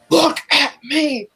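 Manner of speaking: a lightly voiced villain taunting his captive; creepy villain. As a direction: stretch "Look at me" out like a menacing taunt. Villain repeats line, second slow, drawn.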